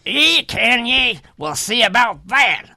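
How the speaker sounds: a hint of a storybook workman; gruff challenge. Voicing well diggers answering the boast.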